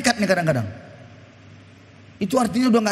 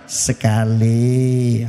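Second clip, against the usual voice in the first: much lower, very slow single word; a deep, drawn-out "Sekali" from a wife keeping score; wife's ominous count; 11 semitones lower, much slower than usual. Retold or imitated. Wife warns husband again.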